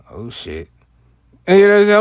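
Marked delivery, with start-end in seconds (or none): 0.1-0.7 much softer, a dread-filled aside to himself; hushed oh shit. Retold or imitated. Kid realizing trouble, hushed and uneasy. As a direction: breathe it under the breath. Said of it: "And I'm like".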